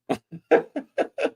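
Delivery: short real laugh in disbelief. Real, not acted. Host laughs at the clip in disbelief, real laugh.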